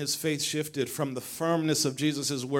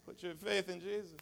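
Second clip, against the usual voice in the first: soft echo; 2 semitones higher, 12 dB quieter than usual. Acted. Echo, suddenly much softer.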